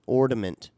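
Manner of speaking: "ornament" as or-duh-ment; D in the middle. Retold or imitated. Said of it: "puts a D in the middle".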